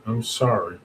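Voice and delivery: ordinary talk, nothing marked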